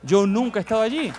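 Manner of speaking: emphatic insistence. Real, not acted. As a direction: drive the denial home, pitch lifting at the end. Preacher repeats his denial for emphasis.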